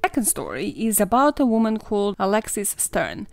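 usual speaking voice

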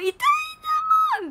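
put-on very high, slow childish wail; whiny protest. Retelling her whiny protest on the rollercoaster. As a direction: whine it like a hurt kid. Retold whiny shout of pain.

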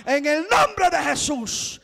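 prayer that builds from a near-chant to a high shout; healing prayer swelling into a loud, high rebuke; fervent healing prayer. Retold or imitated. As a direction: command the sickness to leave, rising to a cry. Prayer over dying woman, hands laid.

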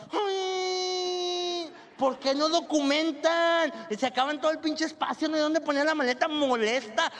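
high, whining complaint with a cry; whiny chubby woman. Retold or imitated. Passenger complaining about luggage space, high whine. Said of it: "la voz chillona que te cae mal".

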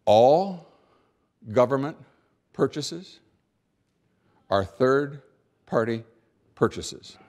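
"All", "government", "purchases", "are third-party", "purchases" each set apart by long pauses, "third-party" drawn out slowly; pause for emphasis. Shown as a demonstration. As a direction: space every word out with long pauses, slowing right down on "third-party". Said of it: "I'm now going to pause for emphasis".

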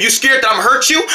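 ordinary delivery

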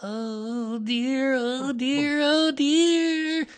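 small animal fretting in a high voice; worried Piglet. A narrator's reading. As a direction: squeal out mounting dread as a tiny creature. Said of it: "said Piglet to himself".

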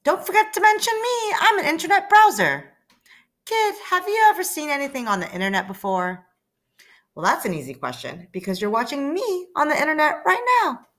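pushy browser wanting attention; browser character. Acted.

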